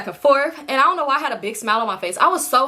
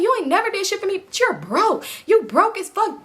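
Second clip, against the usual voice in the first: going off; 8 semitones higher than usual. Retold or imitated. Quiet friend going off on her.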